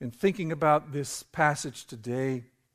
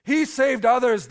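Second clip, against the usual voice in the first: scoffing; 9 semitones higher, 7 dB louder than usual. Acted. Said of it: "they scoffed".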